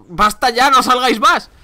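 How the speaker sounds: high, loud fed-up cry; pleading shout, slowing down; exasperated shout. Real, not acted. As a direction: yell at the endless bugs. Fed up with spawning enemies.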